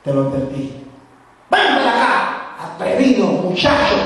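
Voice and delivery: put-on furious father calling the boy over; angry father. Father waiting in the street, loud angry threat.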